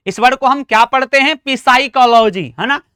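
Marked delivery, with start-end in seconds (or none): 1.4-2.5 पिसाइकोलॉजी with the p sounded; wrong pronunciation. Shown as a demonstration. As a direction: add a pi- syllable at the start of पिसाइकोलॉजी. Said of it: "तो ऐसा नहीं पढ़ते". (पिसाइकोलॉजी में 'प' बोलकर गलत उच्चारण)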